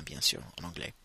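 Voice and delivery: normal talking voice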